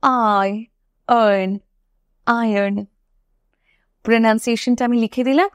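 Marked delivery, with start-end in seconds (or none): 0.0-1.6 "আয়রন" slowly split into eye, urn; syllable-by-syllable pronunciation. Shown as a demonstration. Teacher breaks down iron for learners, slow syllable by syllable. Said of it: "সঠিক pronunciation হচ্ছে". 2.3-2.8 "আয়রন" joined up as eye-urn; correct iron pronunciation. Shown as a demonstration. Teacher repeats iron for learners, correctly pronounced eye-urn. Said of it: "সঠিক pronunciation হচ্ছে".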